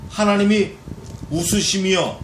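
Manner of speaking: usual speaking voice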